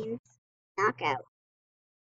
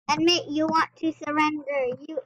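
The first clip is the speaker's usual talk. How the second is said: ordinary talk, nothing marked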